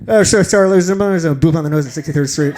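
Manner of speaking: hurried PA announcement; train announcer. Acted. Conductor to passengers over the speaker, rushed announcement. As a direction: rattle it off like a voice over the station speaker. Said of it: "a voice come over the speaker".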